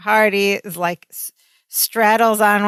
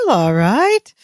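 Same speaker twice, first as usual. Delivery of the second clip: put-on Laurel accent. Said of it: "in Laurel's accent".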